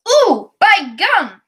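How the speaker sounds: slow, loud startled cry; a drawn-out amazed ooh; surprised exclamation. Acted. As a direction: let it burst out in surprise. Door unexpectedly opens.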